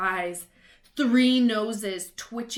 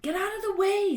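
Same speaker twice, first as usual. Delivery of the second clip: higher, sharp angry retort; snapped; 11 semitones higher, 4 dB louder than usual. A narrator's reading. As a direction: snap at the poltergeist. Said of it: "snapped Ron".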